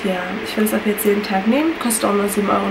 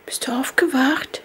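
whispering; 4 semitones higher, slower than usual. Real, not acted. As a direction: gently whisper so she stays calm. Whispering to waking baby.

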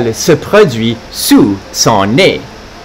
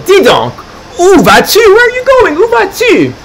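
much higher squeaky pug voice; indignant calling. Acted. Carlo yelling after departing Santa, high and indignant. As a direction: demand where he's going.

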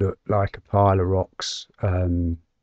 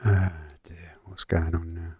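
whispered under the breath; whispering; 3 semitones lower, 7 dB quieter than usual. Real, not acted. Equipment failing, muttering to self.

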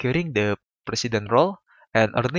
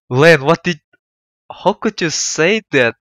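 stunned disbelief; 4 semitones higher, 6 dB louder than usual. Real, not acted. Shocked reaction to Len's insult.

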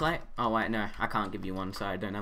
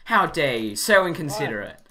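louder, higher hammed-up indignation; mock outrage; 4 semitones higher, 5 dB louder than usual. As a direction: scold him in fake offense.

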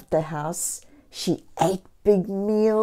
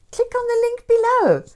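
high, fast sing-song; excited push; 6 dB louder, faster than usual. Real, not acted. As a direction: chirp the call to action. Sign-off.

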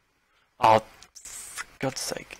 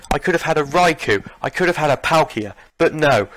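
ordinary talk

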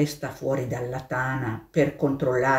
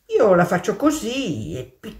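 high, slow hare voice; a much higher, drawn-out hare brushing it off; 5 semitones higher, 3 dB louder than usual. Acted. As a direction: dismiss it airily. Hare answers the mole.

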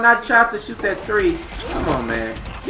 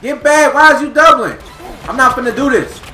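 frustrated yell; 7 semitones higher, 7 dB louder than usual. Real, not acted. Yelling at the game.

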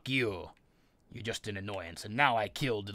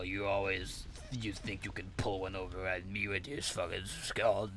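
ordinary talk, nothing marked

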